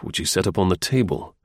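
plain reading voice

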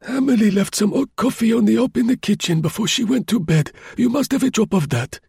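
put-on high old man's voice, hospitable; old Grosjean being helpful; kindly old concierge. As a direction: offer the coffee like a frail old host. Grosjean offers coffee.

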